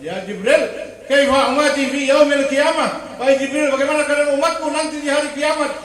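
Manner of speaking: loud, high declamation; full-force preaching. Real, not acted. Preacher voices the Prophet asking Jibril about his people, full-force preaching. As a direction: proclaim the question at the top of the voice.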